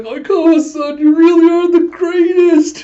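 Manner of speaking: very high, loud fangirl squeal; losing it, gushing. Retold or imitated. Retelling Hinata praising his partner, hammed-up ecstatic squeal. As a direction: gush in over-the-top adoration, stretching the words. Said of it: "Nana's just losing".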